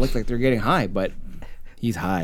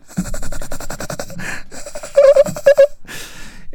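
very high endless giggle; long haunting mock cackle; imitated laughter. Laughter haunts him in the corner, high giggle. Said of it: "he just wants to stop".

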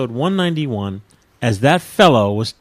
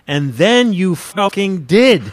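all caps; 7 semitones higher, 6 dB louder, slower than usual. Retold or imitated. Reading the letter's all-caps line, loud and slow. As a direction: read as if in all caps, higher and louder. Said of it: "And this is in all caps".

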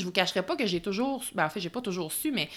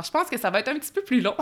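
laughing; 4 semitones higher, 5 dB louder, faster than usual. Real, not acted. Admits episode runs long.

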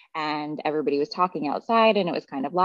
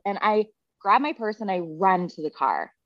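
ordinary talk

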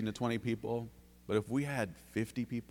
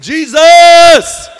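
far higher, far louder desperate cry; blind man's yell; 26 dB louder than usual. Retold or imitated. Blind Bartimaeus calling out to Jesus, full-throated yell. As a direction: shout the name across the road. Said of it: "yell his name out".

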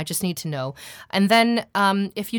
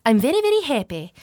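high, royal-drama English accent; The Crown-style British accent; 7 semitones higher, 5 dB louder than usual. Shown as a demonstration. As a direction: put on regal Received Pronunciation, pitched up. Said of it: "for the crown sort of sound".

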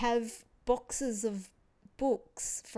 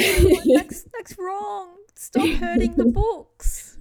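mock horrified protest; 10 semitones higher, 13 dB louder than usual. Book lover begging friend's husband to stop, mock-anguished cry. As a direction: plead loudly in mock outrage. Mock horror at damaged books.

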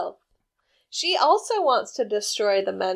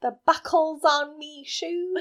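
badly faked Irish leprechaun; hammy movie leprechaun Irish; mock Irish accent; 4 semitones higher than usual. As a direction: do a half-hearted Irish accent, a bit higher. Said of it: "did not even try to do an Irish accent".

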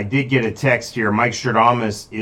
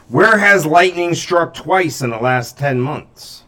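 slow, higher, louder mystic reveal; grand mystic reveal; 6 semitones higher, 4 dB louder, slower than usual. Acted. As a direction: unveil it slowly like a seer.

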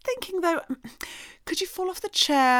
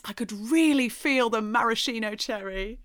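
ordinary delivery

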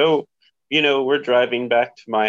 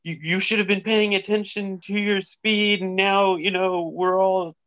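higher whiny nagging voice; whiny scolding; 8 semitones higher than usual. Retold or imitated. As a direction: mimic a nagging passenger. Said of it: "she's like".

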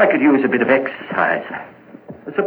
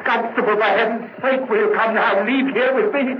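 high, frantic pleading; high-pitched, urgent nervous begging; agitated pleading; 10 semitones higher than usual. Acted. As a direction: beg him to leave, panic rising. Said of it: "there's no need to get so excited".

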